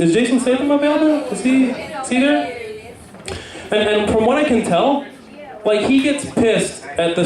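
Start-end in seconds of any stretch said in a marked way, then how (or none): 0.0-2.6 calling out. Pretend. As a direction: shout for him like a casting assistant. Studio calling out for actor.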